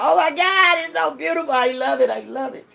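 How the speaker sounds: imitated delight. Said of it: "She was like".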